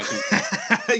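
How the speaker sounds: breaking into laughter mid-sentence; words lost in a high laugh. Real, not acted. Laughing at childhood speech problem.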